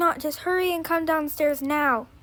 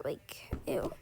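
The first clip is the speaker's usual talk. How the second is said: whispered ew; 14 semitones lower, 13 dB quieter than usual. Pretend. Disgusted at old shelter stuff.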